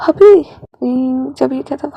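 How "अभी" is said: sudden loud high snap; sharp loud now. Acted. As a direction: burst out the word. Wing snaps loudly. (ज़ोर से अचानक बोला गया)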